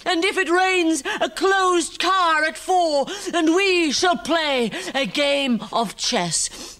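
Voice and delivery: a wife unravelling, laughing wildly; hysterical woman. Acted.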